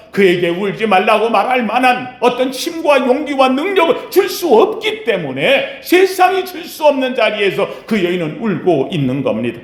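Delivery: normal talking voice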